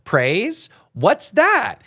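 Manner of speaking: put-on high, slow, bouncy kids' show cheer; excited cartoon voice. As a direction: chirp it like a children's cartoon host. Cartoon praise song quoted excitedly.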